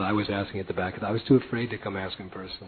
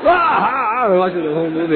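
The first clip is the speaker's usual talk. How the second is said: high startled ah, then a rush; surprised at the master watching; mock surprise; 9 semitones higher, 8 dB louder than usual. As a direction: gasp in mock shock.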